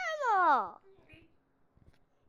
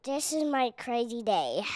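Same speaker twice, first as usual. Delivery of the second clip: crazy voice; 8 semitones lower than usual. Acted. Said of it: "You have a crazy voice".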